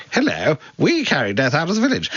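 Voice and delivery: high, piping posh southern English voice; idiotic posh southern English. Retold or imitated. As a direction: send up a silly, plummy southern English accent. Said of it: "this idiotic posh southern voice".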